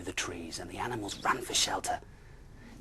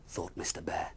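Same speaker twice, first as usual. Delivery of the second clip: ordinary delivery, nothing marked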